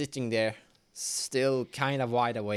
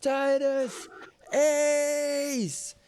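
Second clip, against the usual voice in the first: high, drawn-out calling shout; high-pitched call across a distance; 13 semitones higher, 3 dB louder, much slower than usual. Acted. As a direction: shout the names out to distant companions. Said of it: "You shout".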